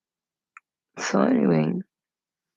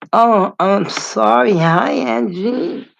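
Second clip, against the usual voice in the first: high, loud, drawn-out hello; gushing, sing-out greeting, pitched up; effusive greeting; 5 semitones higher, 8 dB louder, slower than usual. Real, not acted. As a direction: stretch out a delighted apology and hello. Real greeting to viewer just noticed.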